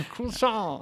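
high-pitched fake French croissant; mock French. Co-host capping the French joke, high mock French. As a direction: squeal the word in a silly French accent.

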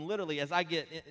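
ordinary talk, nothing marked

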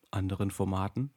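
usual speaking voice

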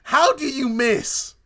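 loud, high, drawn-out disbelief; real exasperated question, slow and high; loud incredulity. Real, not acted. Incredulous at character missing.

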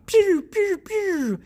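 high piu piu laser noise; laser sound effect. Pretend. Storyteller imitating the laser microphone firing, high piu piu. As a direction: zap like a toy ray gun.

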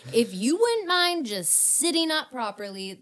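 exasperated passenger pleading with mock politeness; exasperated request. Talking to a drunk cab driver, exasperated.

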